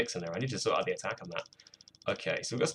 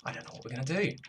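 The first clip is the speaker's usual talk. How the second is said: higher, rising note of helplessness; unintentionally desperate; 5 semitones higher, 4 dB quieter, faster than usual. Real, not acted. Producer unsure what to do next, accidentally desperate. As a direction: let the end climb, a touch desperate. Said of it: "that sounded more desperate than I meant it".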